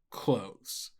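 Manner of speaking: ordinary delivery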